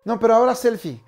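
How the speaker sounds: mock insistent fan voice; pushy fan demands. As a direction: pile on request after request, quickly. Fan keeps demanding more.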